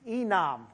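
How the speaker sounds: "enam" carefully sounded out as ee-NAHM; careful Hebrew pronunciation. Shown as a demonstration. Preacher teaching the Hebrew word for faith, "enam" pronounced carefully as ee-NAHM. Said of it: "let me pronounce it right".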